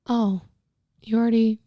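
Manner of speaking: halting, deflated dad; retold disappointment. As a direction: trail off, mildly crestfallen. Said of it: "He was so sad".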